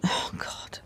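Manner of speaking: soft unvoiced whisper; whispered oh God. Real, not acted. Woman fretting about her bad knees, whispered aside. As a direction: breathe it out in a hushed whisper. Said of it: "I feel like I'm gonna fall over".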